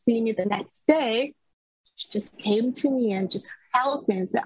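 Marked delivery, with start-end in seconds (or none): none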